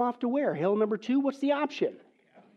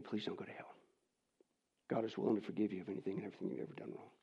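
hushed, lower, begging; a quiet tender plea to the lost; soft pleading; 11 semitones lower, 13 dB quieter, faster than usual. Real, not acted. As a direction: beg them gently, almost whispering.

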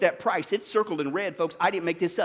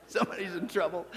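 laughing; 4 semitones lower, 9 dB quieter than usual. Real, not acted. Laughing after the clip.

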